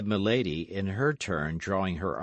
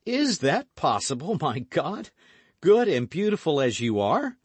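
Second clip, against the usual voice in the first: high, loud mock disbelief; feigned surprise; 9 semitones higher, 7 dB louder than usual. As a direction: lay on the astonishment. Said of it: "affecting surprise".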